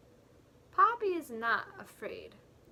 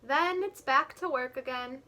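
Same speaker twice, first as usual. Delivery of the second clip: ordinary talk, nothing marked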